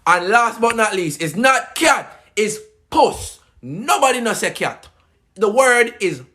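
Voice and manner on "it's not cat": "cat" stressed high as kyat; slow down and hit "cat" high; emphatic correction. Shown as a demonstration. Correcting the word for Jamaicans, "cat" punched as kyat.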